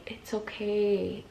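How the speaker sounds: whispered, slow reassurance; hushed, drawn-out comforting whisper; soothing demeanor. Retold or imitated. Showing how she calmed the anxious man, soothing whisper. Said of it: "with this, like, demeanor".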